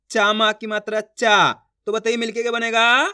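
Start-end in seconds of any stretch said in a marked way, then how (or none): 0.1-0.3 चा held long with a falling tune. Shown as a demonstration. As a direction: stretch चा out and let it fall. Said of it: "मेरा तरीका देखना है". (चा का आ खींचकर)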